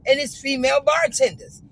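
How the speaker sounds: loud, high, exasperated complaint; loud rant. Retold or imitated. Ranting to the bartender about rude service, loud and exasperated. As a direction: vent the whole grievance at full volume.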